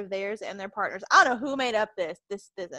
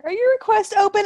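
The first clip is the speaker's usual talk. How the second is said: high mock fan voice; 11 semitones higher, 10 dB louder than usual. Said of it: "People messaging me on here".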